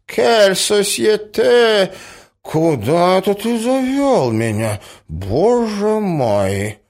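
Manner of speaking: high, drawn-out, plaintive voice; distraught old prince. A narrator's reading. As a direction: beg to be led away, helpless. Acts the overwhelmed old prince. (растерянный князь, высоко и жалобно)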